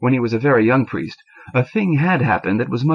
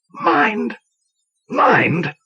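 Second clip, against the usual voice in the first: priest yelling his disbelief, the first much higher; yelled; 10 semitones higher than usual. A narrator's reading. Old priest yelling at the young man through the grill. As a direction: shout the word back in outrage. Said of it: "yelled the priest".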